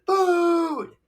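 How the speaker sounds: high, loud cartoon shout; mock shout. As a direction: yell the word Food in his voice. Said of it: "he says".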